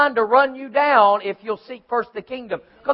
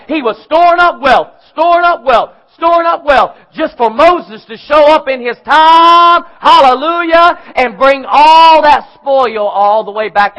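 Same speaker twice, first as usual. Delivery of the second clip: louder, rising preacher drive; preaching at full force; 3 semitones higher, 5 dB louder than usual. Acted. Preacher driving home God's provision to the congregation, fervent and loud. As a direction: build the sermon to a fervent pitch.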